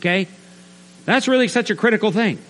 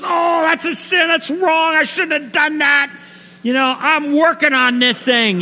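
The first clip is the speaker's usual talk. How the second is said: high-pitched, gushing mock apology; frantic apology; 10 semitones higher than usual. New believer apologizes after punching a coworker, frantic. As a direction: wail it like a panicky wrongdoer.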